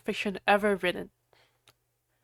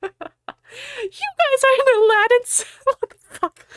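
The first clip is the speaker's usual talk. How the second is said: high, breaking into real laughter; laughing break; 4 dB louder than usual. Real, not acted. Reader cracking up rereading the line.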